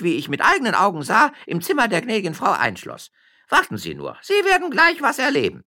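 put-on high, loud scolding old nurse. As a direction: tell the drunk off from the window. (Amme schimpft laut den vermeintlichen Trunkenbold aus)

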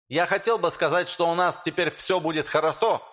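high, lisping speech with sh for s. Acted. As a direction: lisp through a rousing public speech. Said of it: "По шепелявому голосу".

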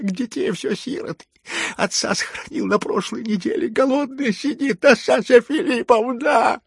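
very high, loud frantic begging; wailing plea. A narrator's reading. Lebedev begging to climb into the fireplace, wailing. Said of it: "вопил Лебедев, ползая на коленках".

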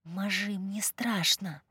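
soft scared whisper, slow; frightened whisper. Acted. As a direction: whisper it like a scared little girl. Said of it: "Мармар повернулась ко мне и прошептала".

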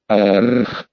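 low guttural animal roar; beastly roar. A narrator's reading. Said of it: "издал звериный рёв".

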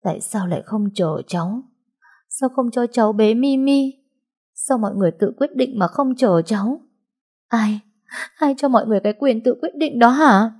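grieving mother confronting the family; tearful protest. Acted. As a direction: accuse them through tears. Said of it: "Nước mắt tôi lã chã rơi xuống".

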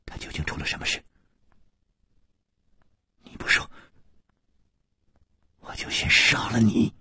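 hushed, breathy menace under the breath; soft threat. A narrator's reading. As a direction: threaten very softly, leaving long pauses. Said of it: "轻轻地说".

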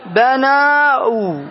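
بناوا drawn out slowly as bana-wa, keeping the alif; slow demo of بناوا with both alif and waw; hypothetical form. Shown as a demonstration. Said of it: "صارت بناوا". (نطق بطيء لـ بناوا بالألف والواو)

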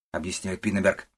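ordinary delivery, nothing marked

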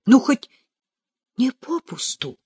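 high, eager, halting query; impatient question. A narrator's reading. Katya asking if it was worth it, impatient. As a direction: ask it high, unable to wait. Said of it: "не вытерпела Катя".

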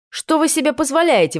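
high-pitched outraged challenge; indignant. A narrator's reading. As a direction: protest like an offended princess. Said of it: "возмутилась я".

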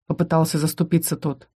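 usual speaking voice

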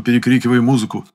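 plain reading voice

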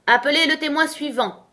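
high, loud court summons; commanding call. A narrator's reading. King summoning the next witness in court, commanding call.

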